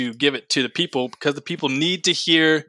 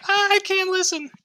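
much higher, whining; high whiny sister. Retold or imitated. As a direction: whine it in high falsetto. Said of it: "the other ones were like".

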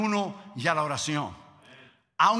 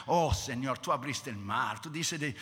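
soft praise; 7 semitones lower, 4 dB quieter than usual. Retold or imitated. Moses praising God.

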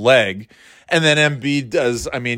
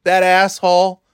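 mock sports-radio host; 8 semitones higher than usual. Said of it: "It's like".

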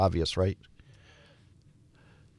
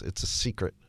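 confiding hush; 4 semitones lower, 6 dB quieter than usual. Retold or imitated. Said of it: "like he didn't want anybody to hear".

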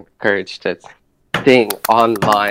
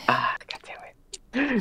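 whispering under breath; whispered curse; 13 semitones higher, 10 dB quieter, slower than usual. Real, not acted. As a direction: whisper it in frustration. Forced to sing.

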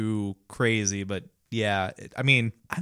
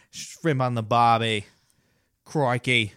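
put-on Australian accent. Imitating Australian podcasters, mock Australian accent. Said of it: "obviously the accent and everything".

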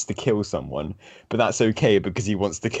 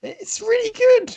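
gushing; 6 dB louder than usual. Real, not acted. Gushing about a favourite album.

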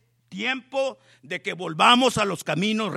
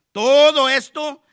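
loud, slow, drawn out todo esto; emphatic shout; 5 semitones higher, 12 dB louder than usual. Real, not acted. Preacher to the church, booming slow emphasis. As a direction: hammer the words home to the congregation.

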